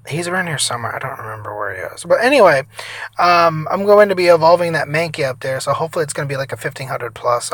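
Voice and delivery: ordinary talk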